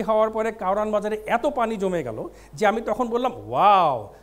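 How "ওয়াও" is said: loud, drawn-out wow; hammed-up wonder, loud and falling; mock amazement. Comic recalling flooded bazaar, mock amazement.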